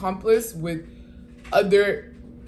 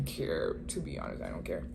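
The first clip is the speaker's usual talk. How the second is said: breathy whisper, I don't care; muttered under the breath, unbothered; whispered aside; 13 dB quieter than usual. Real, not acted. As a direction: drop to a whisper to shrug it off. Dismissive aside about being overheard.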